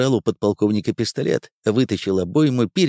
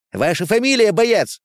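high, indignant official question; stern demand; 10 semitones higher than usual. Acted. Angry officer demands the soldier's name, stern. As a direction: demand it sharply, pitch raised.

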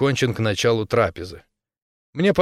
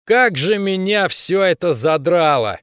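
higher, slow exasperated yell; fed-up shout; 6 semitones higher, 4 dB louder, slower than usual. Acted. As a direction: vent total frustration. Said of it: "громко крикнул".

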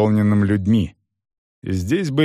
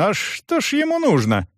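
puzzled, high-pitched question; surprised baron; 11 semitones higher than usual. A narrator's reading. Baron asks about the troublemaker, surprised. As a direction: ask it in open surprise. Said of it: "удивился барон".

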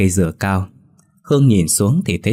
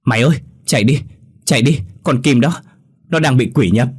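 panicked cry; 5 semitones higher, 3 dB louder than usual. A narrator's reading. Minh warns a figure running toward them, panicked shout. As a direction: call out high and loud in panic. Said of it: "hoảng hốt kêu lên".

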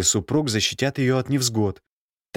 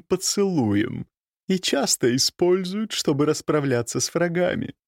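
higher, poised noblewoman voice; countess voice; 8 semitones higher than usual. A narrator's reading. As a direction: speak as a cool, knowing countess.

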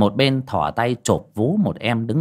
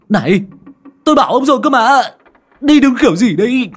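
much higher and louder scolding; angry yell; 13 semitones higher, 9 dB louder, faster than usual. A narrator's reading. Master thrown forward scolding his driver, angry yell. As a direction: blast him for braking.

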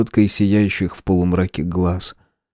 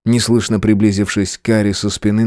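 ordinary delivery

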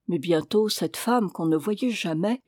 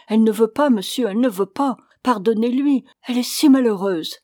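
loud, higher-pitched desperate plea; crying out; 3 semitones higher, 6 dB louder, faster than usual. A narrator's reading. Old maid on her knees begging the officer, crying out. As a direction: cry out as a pleading servant.

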